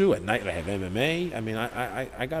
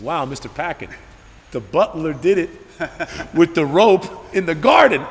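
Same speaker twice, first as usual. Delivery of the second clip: giddy, louder, laughing Clue punchline; playful joke; 6 semitones higher, 7 dB louder than usual. Retold or imitated. Teen joking to a rich dad, laughing and playful. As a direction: ham up the joke to your friend's dad. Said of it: "I said".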